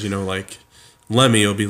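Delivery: ordinary delivery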